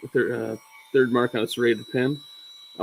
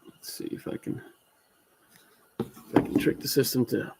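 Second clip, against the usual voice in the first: muttering to himself; 6 dB quieter than usual. Real, not acted. Muttering while fiddling with camera.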